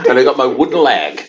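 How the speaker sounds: put-on high, laughing pirate with Devon accent; playful West Country pirate voice. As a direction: ham it up as a comic pirate. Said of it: "You sound like you're in Devon".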